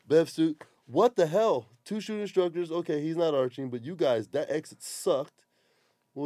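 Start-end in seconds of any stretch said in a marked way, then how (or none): none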